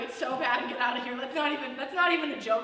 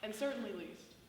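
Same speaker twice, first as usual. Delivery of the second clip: loud host announcing the next act; emcee voice; 2 semitones higher, 8 dB louder than usual. Real, not acted. To the crowd, introducing the next comic, a loud emcee voice. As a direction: pump it up like an emcee.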